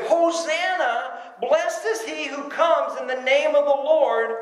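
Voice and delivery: a hint of a crowd crying out, high and slow; crowd's cry. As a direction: lightly call out Hosanna like the crowd. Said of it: "They cried out as loud as they could".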